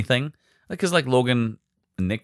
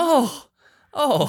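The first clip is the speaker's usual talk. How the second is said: put-on high, sheepish kid; lightly voiced startled boy. Son realises missing sock.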